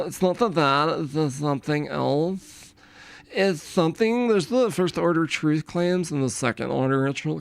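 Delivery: mocking prissy debater voice, slowed; lightly mock the way they talk; mean girl impression. Streamer mocking online debaters, a light mean-girl voice. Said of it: "cheerleader mean girls. They're like".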